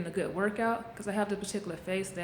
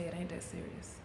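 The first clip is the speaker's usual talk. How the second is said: quiet self-reassurance; 8 dB quieter than usual. Retold or imitated. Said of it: "I just say".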